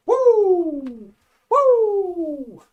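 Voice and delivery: high, loud, long "woo"; drawn-out high-pitched woo; hyped-up whoop. Real, not acted. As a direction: whoop it up to get energized. Hyping up to talk faster.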